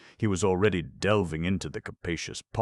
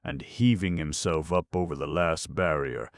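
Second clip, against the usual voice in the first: usual speaking voice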